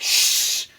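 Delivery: whispered shush. Retold or imitated. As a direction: hiss a quick shush. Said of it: "And she's like".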